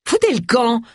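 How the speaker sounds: high, sharp bark of dismissal; barked order. A narrator's reading. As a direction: bark it high to send them packing. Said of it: "aboya-t-elle". (ordre aboyé, voix aiguë)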